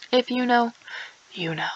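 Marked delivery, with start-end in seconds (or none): 1.3-1.7 hushed, secretive whisper. Acted. To viewers, a knowing whispered aside. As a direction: breathe it like a secret.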